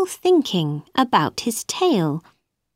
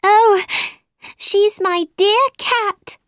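put-on high, eager girlish exclamation; delighted cry; 12 semitones higher, 3 dB louder than usual. A narrator's reading. Alice telling the parrot of her cat, eager cry. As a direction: exclaim it high, starting loud. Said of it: "cried Alice".

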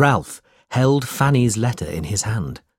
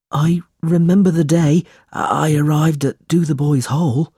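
higher-pitched, plaintive character voice; timid, troubled boy; 6 semitones higher, 4 dB louder than usual. A narrator's reading. Smike telling Nicholas his childhood memories, timid and troubled. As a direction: recall it in a high, uneasy voice.

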